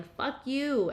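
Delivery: high yelled curse; yelling. A narrator's reading. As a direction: yell it up high. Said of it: "Quendy was still yelling".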